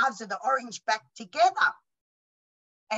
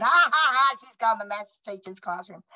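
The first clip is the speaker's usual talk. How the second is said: mocking schoolkids; 5 dB louder than usual. Kids ridiculing her.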